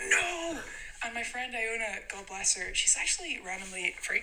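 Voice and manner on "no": high mock wail of panic; retold dread. Girls spotted by the Russians in car park, high mock-panicked cry. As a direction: cry it out in comic horror.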